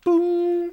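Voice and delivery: high sung ding like a cabin chime; mock chime sound. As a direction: imitate the seatbelt light chime. Streamer mimics airplane chime.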